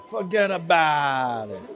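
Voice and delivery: laughing New York Italian-American "forget about it"; hammed-up New York wiseguy accent. To the camera, mocking the booth in a New York accent. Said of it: "went all New York there with the accent".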